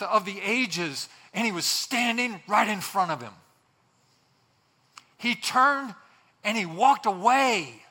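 high, loud preaching with long pauses; impassioned preaching. Real, not acted. Preacher on Pilate walking away from truth, impassioned. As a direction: drive each phrase home slowly and forcefully.

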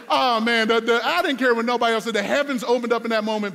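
loud, high, laughing jubilation; elated outburst. Acted. Student finally praised by his strict teacher, elated shout. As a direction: burst out overjoyed at finally being praised.

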